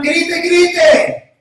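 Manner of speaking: high, loud shout. Acted. Preacher acting the blind men yelling, loud shout. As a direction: yell it out. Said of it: "Ellos están".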